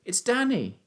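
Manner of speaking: put-on high boyish call; shouting. A narrator's reading. Son tells missing father who is calling, shouting. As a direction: call your name out high. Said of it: "I shouted".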